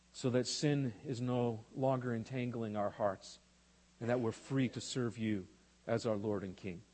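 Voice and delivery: soft, low prayer voice. Acted. Pastor praying before the sermon, soft and low. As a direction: pray quietly and humbly to the Father.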